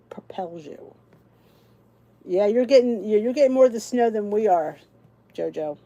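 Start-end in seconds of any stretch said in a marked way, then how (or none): 0.1-0.9 hushed whisper, slow; slow soft whisper. Real, not acted. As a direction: breathe it out softly like a secret. Tender closing thought to viewers.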